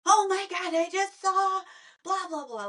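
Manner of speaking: high excited gush. Retold or imitated. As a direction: gush like spotting wildlife. Excited nature recording retold.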